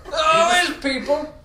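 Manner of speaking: normal talking voice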